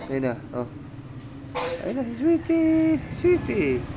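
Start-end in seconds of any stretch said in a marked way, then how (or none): 1.8-3.8 very high, slow delighted squeal of the name; squealing pet greeting. Real, not acted. Owner spotting Sweetie, squealing her name high.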